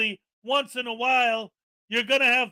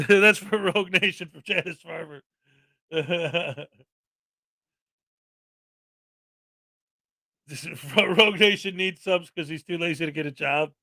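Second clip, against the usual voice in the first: giggly, lower-pitched read-out; real laughter while reading; 6 semitones lower than usual. Real, not acted. Host reading viewer messages about Rogue Nation, laughing. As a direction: read it out while breaking into chuckles.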